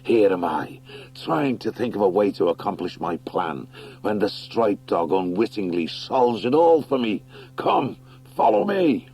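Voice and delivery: gloating wildcat. Acted. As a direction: purr the triumph, then call out.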